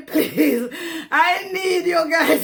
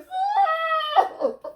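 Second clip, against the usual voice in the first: high wailing laugh-cry. Real, not acted. Vlogger apologizing to viewers, high wailing laugh-sob. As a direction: let the laugh crack into a cry.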